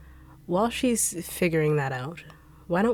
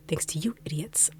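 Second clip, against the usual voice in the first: hissed scolding; 5 dB quieter than usual. Acted. Scolding henchmen quietly.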